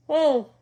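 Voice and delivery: loud open yawn sliding high; big yawn. Real, not acted. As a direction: let out a big stretching yawn. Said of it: "sorry for the yawn".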